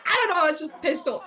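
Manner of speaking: a mock flustered blurt; exasperated outburst. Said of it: "I was like".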